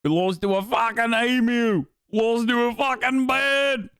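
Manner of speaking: mock-incredulous Australian, building to a high shout; hammed-up Aussie outrage; Australian accent. Streamer taunting Australians over the lost emu battle, mock Australian accent. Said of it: "my Australian accent".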